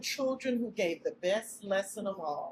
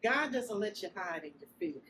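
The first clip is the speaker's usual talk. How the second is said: ordinary talk, nothing marked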